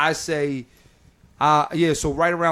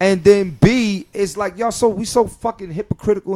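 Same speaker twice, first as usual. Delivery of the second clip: louder and higher, building into a rant; heated rant; 6 semitones higher, 8 dB louder than usual. Real, not acted. Comic venting to podcast hosts about judgmental comics, heated. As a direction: vent it loud and high.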